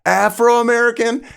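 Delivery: high, loud, questioning holler; yelled back. Retold or imitated. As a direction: shout back what you misheard. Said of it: "yelled back, like".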